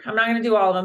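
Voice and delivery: normal talking voice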